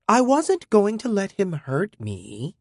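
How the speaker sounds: put-on high, slow scornful girl; overconfident. A narrator's reading. Teen girl insisting to the robot, overconfident. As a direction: insist it, cocky and dismissive. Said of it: "She was scornful and way overconfident".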